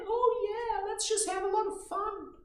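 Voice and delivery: put-on high, loud, airy party-girl voice; mock carefree partygoer. Mocking people who only want fun, high squealing voice. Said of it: "if you're running around like".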